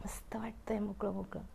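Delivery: soft whispered contentment; contented whisper. Acted. Umbrella whispers contentedly to the cloud. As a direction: savour the freedom under your breath.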